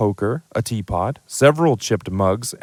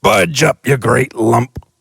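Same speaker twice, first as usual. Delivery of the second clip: loud, high giant voice; giant shoving in; 3 semitones higher, 5 dB louder, slower than usual. Acted. The giant telling Dudley to move, loud and bluff. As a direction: order him over, bluff and rough. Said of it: "said the stranger".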